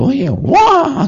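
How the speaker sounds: very high, slow drawn-out wah; sulky child playing up for the visitor; high childish whine. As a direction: stretch it out in a put-on child's voice. Child reacts to neighbour visit.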